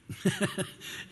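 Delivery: short breathy chuckle; a quick airy laugh; light laugh. Real, not acted. Pastor chuckling at his own example.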